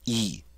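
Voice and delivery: "E" as the double E in see; a pure long "E"; long E sound. Shown as a demonstration. Teacher models a French letter sound, long E. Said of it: "it's that long E sound".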